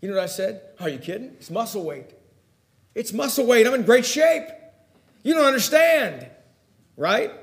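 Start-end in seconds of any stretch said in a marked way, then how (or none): 0.8-2.2 mock dismissive. Acted brush-off of the doctor. 2.9-6.3 higher-pitched mock protest; indignant protest. Patient argues with the doctor, acted indignant protest.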